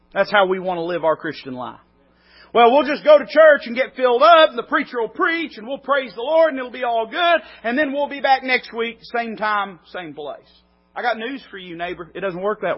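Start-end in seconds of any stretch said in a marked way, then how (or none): 2.5-10.6 a higher, louder, breezy send-up of lazy believers; mock the weekly fill-up mindset; mock complacent churchgoer. Mocking churchgoers' attitude.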